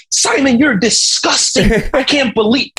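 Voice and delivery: high-pitched mock outrage. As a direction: scold him in an imitated, indignant high voice. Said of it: "ripping into him".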